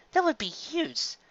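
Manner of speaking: high, hushed and slow; awed soft reply. Acted. Carrot Top stunned by the offer, soft and slow. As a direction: breathe it in disbelief.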